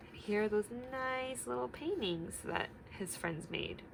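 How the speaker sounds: usual speaking voice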